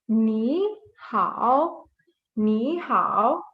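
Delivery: "Ni hao" drawn out with gliding tones; toned model pronunciation. Shown as a demonstration. Teacher reading the first word for beginners, careful toned pronunciation. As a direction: stretch each syllable of "Ni hao", shaping its pitch. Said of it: "Let's read".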